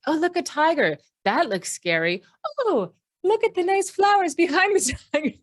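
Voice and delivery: high, airy mock-naive exclamations, breaking into laughter; carefree oblivious ancestor. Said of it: "ancestors who were like".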